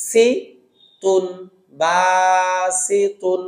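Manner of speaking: drawn-out Arabic recitation, each syllable held long; slow Quranic recitation. Shown as a demonstration. Tajweed teacher modelling words for students, slow recitation. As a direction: recite ওয়া মা হুম বিসুকারা slowly, stretching every vowel. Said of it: "শব্দটা অনেক বেশি practice".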